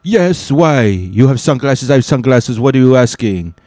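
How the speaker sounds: indignant, puzzled Frenchman with a thick put-on accent. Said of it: "this guy had a French accent".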